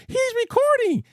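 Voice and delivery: very high-pitched imitation of a screaming wife; wife's mock scream. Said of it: "you hear his wife screams".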